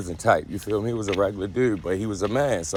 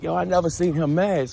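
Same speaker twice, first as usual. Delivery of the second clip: much higher, wide-eyed amazement; high, amazed; 9 semitones higher than usual. Retold or imitated. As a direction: squeak it up in disbelief. Retelling his surprise.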